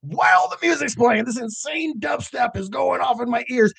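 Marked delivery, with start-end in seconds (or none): none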